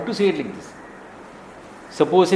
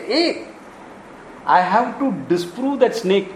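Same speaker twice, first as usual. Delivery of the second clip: mock alarm; 5 semitones higher, slower than usual. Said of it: "you will say".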